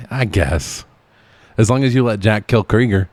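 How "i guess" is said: ordinary talk, nothing marked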